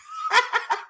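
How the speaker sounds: high burst of laughter. Real, not acted. Laughing at the overdue book felony, high giggle.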